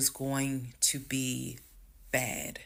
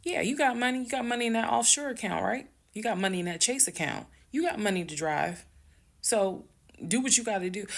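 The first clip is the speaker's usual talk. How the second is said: yelling; 8 semitones higher, faster than usual. Retold or imitated. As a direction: yell it at him, mocking his excuse. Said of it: "I'm yelling".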